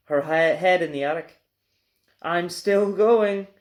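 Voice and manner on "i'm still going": slow, lightly voiced screechy old woman; a hint of an old crone, drawn out slowly. Grandma calls down from the attic, a lightly screechy old voice. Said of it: "the old screechy voice".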